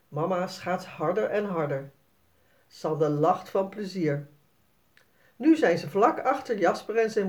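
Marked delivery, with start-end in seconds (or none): none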